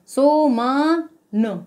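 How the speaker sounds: सो म न, very slow, each syllable held apart; sounded-out syllables. Shown as a demonstration. Teacher demonstrating sounds, सो म न slowly drawn out. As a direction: stretch सो and म, then a short न. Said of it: "इसमें long sound आ रहा है".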